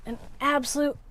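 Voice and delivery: normal talking voice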